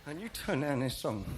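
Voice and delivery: lightly voiced plaintive comic protest; a hint of a comic character's voice; Norman Wisdom. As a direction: complain like a hard-done-by little comedian, lightly put on. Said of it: "sounds like Norma Wisdom".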